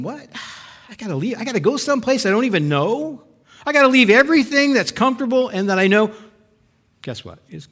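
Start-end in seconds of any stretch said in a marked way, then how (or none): 0.0-6.1 rising alarm. Retold or imitated. Voicing the listener told to leave home, building alarm. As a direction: build from reluctance to protest.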